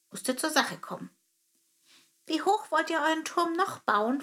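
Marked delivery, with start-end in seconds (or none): 2.3-4.2 high sparrow voice asking; a small bird getting to the point. Acted. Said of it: "fragte er".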